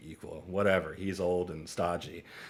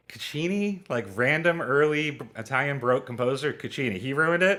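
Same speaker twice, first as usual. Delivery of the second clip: incredulous question; 5 semitones higher, 3 dB louder than usual. Retold or imitated. Teacher hearing surprising answer.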